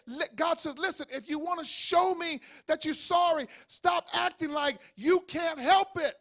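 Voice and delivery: builds from slow to loud shouted preaching; rising to a shout. Acted. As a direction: climb until you're shouting.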